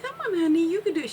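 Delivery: high sweet grandmotherly coaxing; Granny coaxing. Retold or imitated. Granny urging her grandchild to practice readings, high sweet coaxing. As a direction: coax gently like a loving granny. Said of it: "And she's like".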